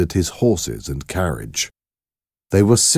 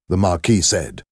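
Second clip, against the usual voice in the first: ordinary delivery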